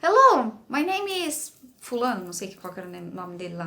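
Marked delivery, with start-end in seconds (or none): 0.0-2.2 loud, higher, overly formal hello; mock stiff teacher greeting a toddler; stiff greeting to a child. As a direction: greet a toddler like an adult stranger. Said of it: "E ele falou".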